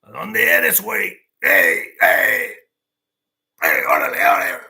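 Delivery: swaggering vato calling out loudly; cocky street tough shouting órale. Retold or imitated. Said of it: "like a vato".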